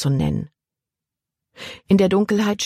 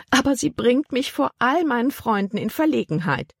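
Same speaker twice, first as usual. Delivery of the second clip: höher, klagende Tante; jammernd; 8 semitones higher than usual. A narrator's reading. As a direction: jammer hoch über die Schande vor Freunden. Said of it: "jammerte Tante Vi". (Tante Vi jammert vor dem Kapitän, höher)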